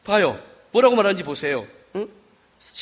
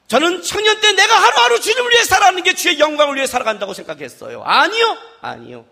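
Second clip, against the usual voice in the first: ordinary talk, nothing marked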